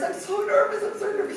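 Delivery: high, anxious repeat of being nervous. Retold or imitated. Sitter in the reading acting nervous for the psychic. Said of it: "and I'm so nervous".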